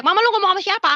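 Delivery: high-pitched mild mock yell; retold shout. Husband calling to his wife, mock shout. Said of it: "Suami saya teriak".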